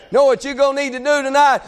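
ordinary talk

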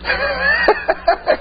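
laugh. Real, not acted. Preacher laughs at his own joke.

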